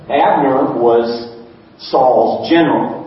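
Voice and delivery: normal talking voice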